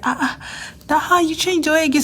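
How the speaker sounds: high, amazed questioning; lab mate's amazement. Retold or imitated. Lab mate amazed at yet another hair change, high surprised question.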